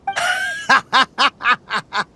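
high, proud hearty laugh. Acted. Boasting after the win. (得意げな高笑い)

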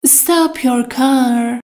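slow, each word laid out clearly; slow model sentence. Shown as a demonstration. Teacher to Persian learners, slow and clear. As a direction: spell it out slowly for learners. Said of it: "teacher models an English sentence".

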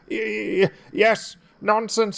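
high, stammering fake bravado; stutter, hiding your fear; nervous stammer. A narrator's reading. James pretends he's not scared of ghost trains, high and stammering. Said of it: "trying not to sound scared".

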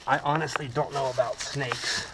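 ordinary talk

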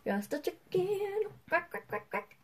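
high, soft chicken act with little quacks; pretend chicken. Hider posing as a believable chicken, high soft quacking. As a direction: play at being a chicken, high and light. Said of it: "Look like a believable chicken".

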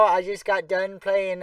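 normal talking voice

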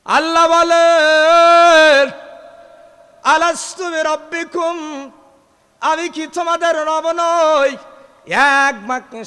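high, loud chanted sermon cry; preaching at full force. Acted.

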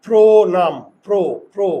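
প্রণাম with a long, loud first syllable held on a closed "o"; jaw demonstration. Shown as a demonstration. Teacher showing the class by jaw movement, প্রো loud and rounded. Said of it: "মুখের চোয়াল দিয়ে শিখি".

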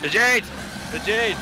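excited calling out. Real, not acted. Spots the jade objective.

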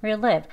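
"where you live" run together quickly; compressed "where you live"; merged into one. Shown as a demonstration. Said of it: "한 소리로 합쳐진 소리처럼".

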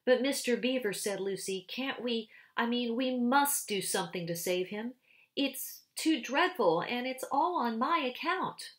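Lucy begging the Beavers to help; pleading girl. A narrator's reading. Lucy pleading to save Tumnus.

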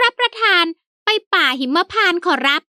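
high, eager fawning servant. A narrator's reading. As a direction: pitch it up as the polite old servant. Servant Haew answers.

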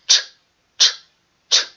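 Ч tapped out short and abrupt, tongue striking; ч said as a quick abrupt burst, one at a time; abrupt ch sounds. Shown as a demonstration. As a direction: clip each ч sharply for the children. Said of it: "Давайте попробуємо".

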